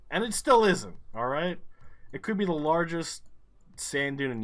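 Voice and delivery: indignant outburst. Real, not acted. Gamer insisting to viewers the dune isn't famous, indignant. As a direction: shout down the claim it's famous.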